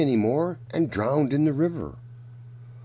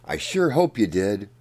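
ordinary delivery, nothing marked

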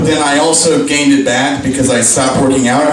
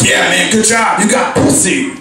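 mock bro cheer, a bit higher; congratulating bro; 4 semitones higher than usual. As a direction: cheer a buddy on in locker-room style. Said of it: "They're like".

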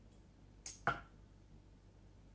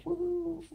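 high, soft airy woo; loopy woo; 14 semitones higher, 4 dB quieter than usual. Acted. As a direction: float up into a spacey little hoot. Voices her brain going loopy.

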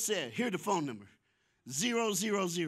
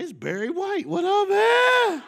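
mock awe; 14 semitones higher, 12 dB louder, slower than usual. Stunned by the deep voice.

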